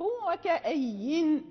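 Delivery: "وكأي" drawn out and ending on a clear n; stop on the nun. Shown as a demonstration. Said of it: "يقف". (الوقف على النون في "وكأي" ببطء)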